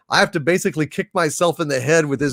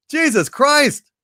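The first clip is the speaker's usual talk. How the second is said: exasperated; 10 semitones higher, slower than usual. Real, not acted. Disbelief at twenty-five minute ad.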